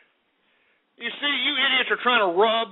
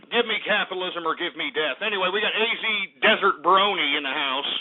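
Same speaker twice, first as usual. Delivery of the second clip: ordinary talk, nothing marked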